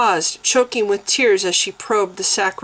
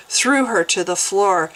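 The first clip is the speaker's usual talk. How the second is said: usual speaking voice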